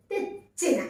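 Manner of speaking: తెచ్చిన with a held catch before చి; pressed double చ. Shown as a demonstration. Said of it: "చా వస్తుంది కాబట్టి".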